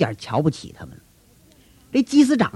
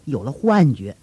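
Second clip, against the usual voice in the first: plain reading voice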